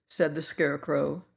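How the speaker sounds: ordinary delivery, nothing marked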